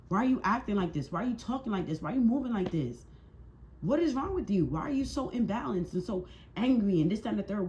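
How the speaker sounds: high, insistent string of questions; exasperated scolding. Real, not acted. Reader taking wrongdoers to task, exasperated scolding.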